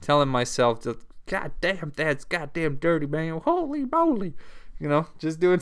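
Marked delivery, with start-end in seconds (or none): 1.2-4.3 a light Southern twang, muttered in amazement; mock Southern mumble, ending on a high Holy moly; talking to himself in a Southern twang, stunned by the dirt. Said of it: "I was literally talking to myself".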